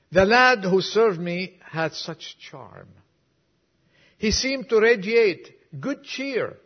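preacher reciting a moving poem to the congregation; poem recital. Acted. Reads a whole poem aloud.

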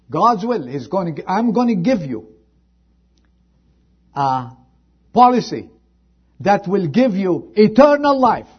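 high, loud scripture call; fiery revival preaching; preaching at full force. Acted. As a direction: thunder it from the pulpit. Preacher builds altar call.

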